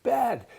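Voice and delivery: high, punched "Bad."; echoing disgust; emphatic repeat. Real, not acted. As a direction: hit "Bad." hard and high. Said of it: "That's bad".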